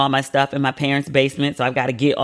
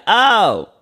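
surprised oh; 8 semitones higher, 6 dB louder than usual. Retold or imitated. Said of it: "And you'll be like".

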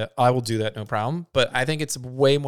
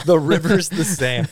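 drawn-out, louder mock echo; mocking mimicry; 5 dB louder, slower than usual. Co-host parroting the other's claim about the river, mocking. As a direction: parrot it back slowly, teasing. Mimics the co-host's protest back.

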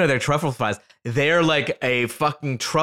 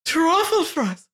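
much higher, slow, swooning rapture; ecstatic over truffle fries; slower than usual. Retold or imitated. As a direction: moan it like the best thing ever. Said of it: "But she was like".

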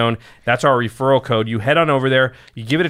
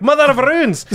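put-on Scottish accent; 11 semitones higher, 4 dB louder than usual. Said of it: "if you say it, like, Scottish".

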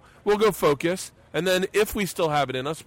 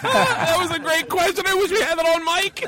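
high, laughing delight, slowed. Real, not acted. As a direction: laugh through the words, much higher. Cracking up at the question.

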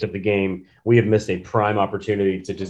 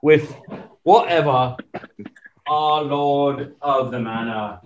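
put-on British accent; 2 semitones higher than usual. Complaining about a receiver in a light British accent. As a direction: grumble like a mock English lord. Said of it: "a little bit of [name]".

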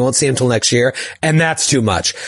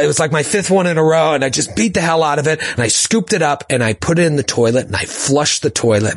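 ordinary talk, nothing marked

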